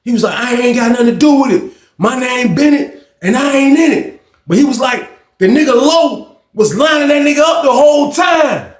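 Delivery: high shouted call; hollering upstairs; shouting through door. Retold or imitated. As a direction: yell it through the vent. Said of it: "He like".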